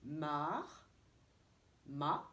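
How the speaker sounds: "mar" with r sounded, then "ma" without r; teacher modelling the pair for repetition. Shown as a demonstration. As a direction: model "mar" and "ma" for children to repeat. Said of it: "Dites-le".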